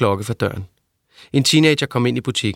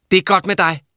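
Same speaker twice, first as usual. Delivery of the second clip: higher, sharp scolding mother voice; a cross old mother, pitched up; 8 semitones higher, 3 dB louder than usual. A narrator's reading. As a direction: nag him for being late.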